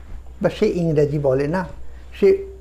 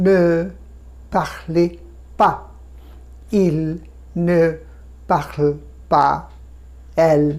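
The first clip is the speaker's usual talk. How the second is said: word-by-word drill; much slower than usual. Shown as a demonstration. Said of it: "আবার দেখুন প্লুরালের দিকে".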